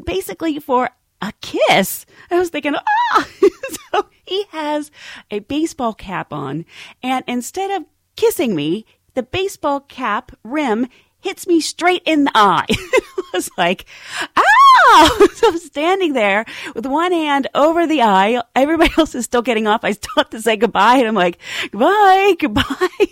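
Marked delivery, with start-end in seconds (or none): 2.8-3.9 very high shriek dissolving into a laugh; shrieking scream then laugh. Retold or imitated. Surprise at attempted kiss. 14.4-15.3 very high, loud cry of pain. Retold or imitated. Hit in the eye. 21.7-23.1 loud, high goodbyes through laughter; laughing pained goodbyes. Retold or imitated. Saying goodbye while hurt.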